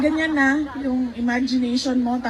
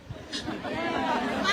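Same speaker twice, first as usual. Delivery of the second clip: a sharp, held-back gasp like a swallowed sob; stifled sob gasp; 5 semitones higher, 10 dB quieter than usual. Shown as a demonstration. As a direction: catch the breath as if stopping a sob. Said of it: "even sobbing is not allowed". (pigil na hikbi, biglang singhap)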